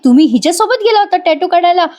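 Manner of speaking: a hint of a jealous wife demanding answers; lightly voiced indignant wife.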